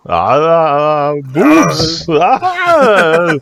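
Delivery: put-on high, loud, hammy Austrian accent; mock Austrian leering groan; Arnold impression. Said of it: "Fucking Arnold".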